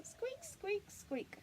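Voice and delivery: very high mouse squeaks; squeaking mouse. Pretend. Reading to a child, mouse squeaks high. Mouse noise in bedtime book.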